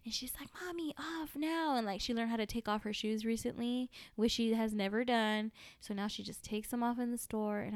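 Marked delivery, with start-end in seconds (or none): 0.6-1.8 put-on slow, higher toddler voice; toddler's demand. Toddler wanting shoes off, imitated slow high demand. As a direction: imitate a little kid insisting, drawn out.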